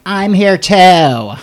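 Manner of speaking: high, loud, slow excited cry; excited shout. Pretend. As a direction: call out cheerfully from the back. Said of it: "being like".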